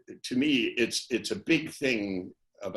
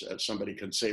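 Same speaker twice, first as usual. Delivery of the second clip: usual speaking voice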